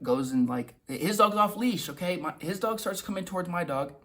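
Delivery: ordinary talk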